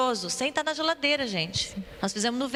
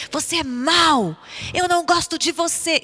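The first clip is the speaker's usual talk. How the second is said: slow, higher and louder scolding; angry scolding; 7 semitones higher, 6 dB louder than usual. Shown as a demonstration. Teacher showing kids how to scold the rice, angrily. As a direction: growl it at the rice like you mean it. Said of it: "tinha que falar com entonação".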